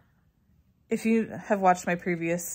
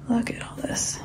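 whispering; 4 semitones higher, 3 dB louder than usual. Real, not acted. Showing off decorations.

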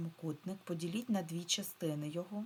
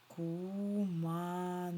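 sounding out slowly; much slower than usual. Shown as a demonstration. Teacher lets pupils hear the last sound, sounding out. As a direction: stretch КУМАНЕЦЬ so every sound is heard. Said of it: "В кінці ми чуємо ць".

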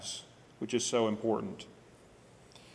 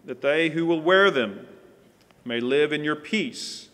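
loud, raised solemn prayer voice; praying aloud; 4 semitones higher, 10 dB louder than usual. Acted. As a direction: proclaim the prayer to the whole room. Ring blessing and prayer.

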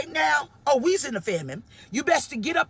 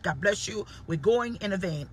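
normal talking voice

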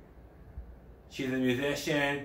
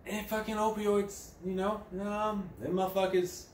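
mock junkie praise; 10 semitones higher than usual. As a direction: croon it like a blissed-out addict. Sarcastic praise voice.

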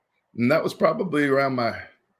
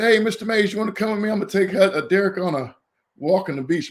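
higher, louder voice for the host; imitating friendly host; 8 semitones higher, 4 dB louder, faster than usual. Player host asks recruit's dad along for a beach walk, imitated hail. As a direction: hail someone's dad in a raised, acted voice.

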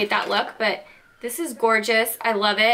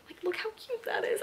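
much higher, soft squeaky coo; cute squeal; 10 semitones higher, 11 dB quieter than usual. Real, not acted. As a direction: coo at something adorable.

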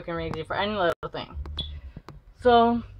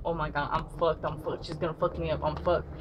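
panicked mutter; 3 semitones lower, 4 dB quieter than usual. Retold or imitated. Narrator panics at being caught.

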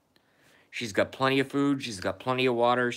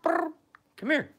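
pet talk. Real, not acted. Coaxing the cat over.

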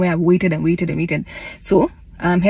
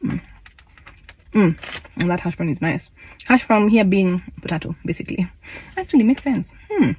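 chewing between words; talking while chewing. Real, not acted. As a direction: mouth partly full. Seen 0: Bit hash brown at -5; lips pressed, chewing between words.

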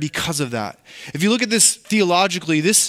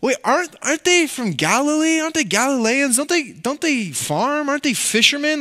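high-pitched mock disbelief; acted incredulous crowd; 9 semitones higher than usual. Preacher voices the crowd doubting the Galileans, incredulous. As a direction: question it in high, put-on bafflement. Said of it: "And the people are saying".

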